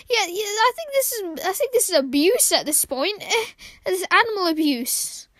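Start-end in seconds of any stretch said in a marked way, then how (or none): none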